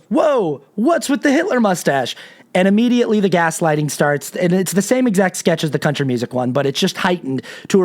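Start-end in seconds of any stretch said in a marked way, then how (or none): 0.1-2.1 higher, taken-aback question; a hint of shocked disbelief; startled friend. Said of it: "Jordan immediately goes".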